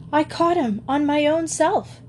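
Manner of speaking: understated, high-pitched scream; mild scream. A narrator's reading. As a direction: cry it out high, without full volume. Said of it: "she screamed".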